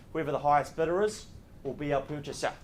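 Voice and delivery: ordinary talk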